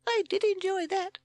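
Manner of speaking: very high, loud squeak; delighted chick. A narrator's reading. Admirer amused by Sid's clowning, loud squeak. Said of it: "said his newfound admirer".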